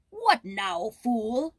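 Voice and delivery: stern king questioning; demanding king. Acted. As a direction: snap at the jester. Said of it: "demanded the king".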